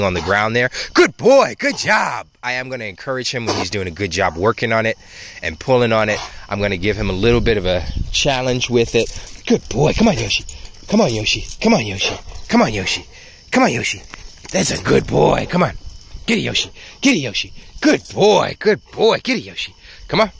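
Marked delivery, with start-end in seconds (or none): 0.9-2.3 high, excited praise for a dog; pitched-up handler's praise; enthusiastic praise. Real, not acted. Praising dog working the toy. 9.5-20.3 urging a dog on in short bursts; playful handler's encouragement; coaxing a dog. Real, not acted. Urging dog on during tug.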